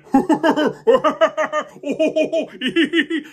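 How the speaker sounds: big high belly laugh; giant laughing. Acted. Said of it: "And the giant".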